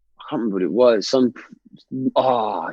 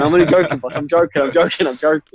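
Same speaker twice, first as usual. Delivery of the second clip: high, hurried acted pleading; mock-flustered soldier, pitched up; panicked backtracking; 6 semitones higher, 4 dB louder than usual. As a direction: blurt it repeatedly to placate the boss. Soldier backtracking to shocked boss.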